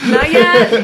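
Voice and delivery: laughing echo. Real, not acted. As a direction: laugh while repeating. Echoing joke, laughing.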